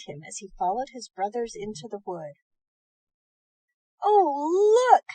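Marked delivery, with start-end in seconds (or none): 4.0-5.0 put-on much higher, louder eager boy; cried out excitedly. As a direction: spot the cottage and point, thrilled. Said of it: "cried Tom".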